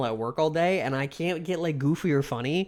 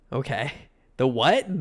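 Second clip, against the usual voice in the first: normal talking voice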